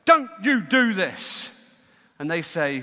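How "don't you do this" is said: loud, slow, raised-pitch command; forceful, drawn-out warning; stern order. Acted. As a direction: order it word by heavy word. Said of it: "And they're ordered".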